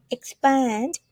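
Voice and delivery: Expand said ik-SPAND, stress on the second syllable; model pronunciation. Shown as a demonstration. Teacher demonstrating Expand to the class, slow and clear. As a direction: say Expand with ik at the start and the weight on SPAND. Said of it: "Whenever you see E X, it pronounced with X".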